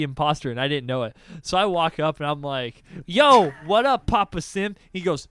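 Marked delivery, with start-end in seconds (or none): 3.1-4.8 high, punchy "yo" hailing a buddy; loud mock greeting. Player hails his friend in Among Us, loud greeting. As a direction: call out a cheery loud greeting.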